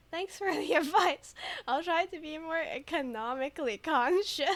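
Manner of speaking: fake-earnest thanks with a laugh in it; mock reply.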